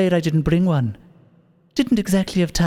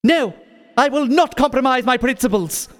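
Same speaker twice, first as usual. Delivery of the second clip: defiant shout; 8 semitones higher than usual. Acted. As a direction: refuse with indignation. Villain refuses loudly.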